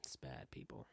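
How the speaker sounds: very low, near-whispered admission; hushed confession. Real, not acted. Comic confessing his hoarding to listeners, hushed. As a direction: drop to a low, soft whisper.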